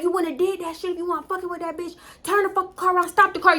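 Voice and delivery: put-on angry girlfriend screaming from the passenger seat; retold yelling. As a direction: yell at him to turn around. She yells at boyfriend.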